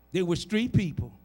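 ordinary talk

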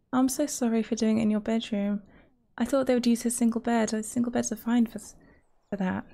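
ordinary delivery

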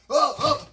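loud uh uh; startled grunts. Retold or imitated. Narrator grabbed by the collar. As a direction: gasp as you're grabbed.

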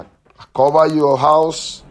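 usual speaking voice

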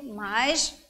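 normal talking voice

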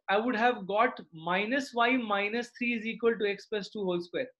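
normal talking voice

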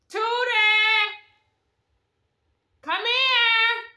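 loud, high, drawn-out holler; calling out. Real, not acted. Host summoning Tootie to join the tasting, calling out. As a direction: holler for someone out of sight. Calls child from another room.

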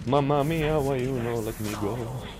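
mild cartoon Italian complaint. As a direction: grumble it like a stock Italian. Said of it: "A stereotypical Italian".

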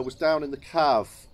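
normal talking voice

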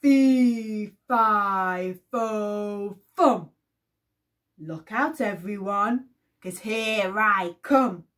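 loud booming giant chant; giant roaring. Acted. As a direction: roar it with the audience. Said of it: "roared the giant".

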